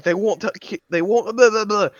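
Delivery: high, loud mock-tantrum sputter; getting angrier. Retelling John complaining to the teacher, angrier and angrier. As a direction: imitate an angry kid complaining, dissolving into babble. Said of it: "keeps getting angrier and angrier".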